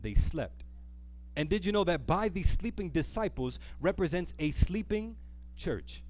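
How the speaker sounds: ordinary delivery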